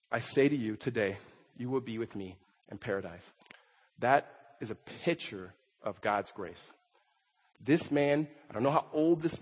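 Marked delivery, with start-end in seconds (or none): 0.1-3.3 very soft, tender promise. Acted. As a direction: promise gently, almost under the breath. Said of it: "here's Jesus' words again".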